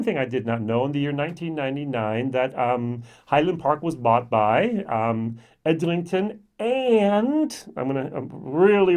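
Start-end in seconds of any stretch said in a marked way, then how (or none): 5.6-7.6 long, slow, high AND after Edrington; stress on AND. Shown as a demonstration. Explaining whiskey ownership to viewers, stretching and. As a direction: lean hard on the word and. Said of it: "really emphasize the word and".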